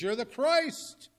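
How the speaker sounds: high, loud, slow proclamation; bold declaration. Acted. Peter answering Jesus who he is, ringing slow declaration. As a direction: proclaim it, drawing out the last word.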